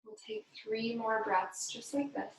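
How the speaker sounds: unhurried, gentle breathing guidance; guided meditation. Acted. Yoga instructor guiding the class through breathing, calm meditation voice. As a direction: lead the class calmly through each breath.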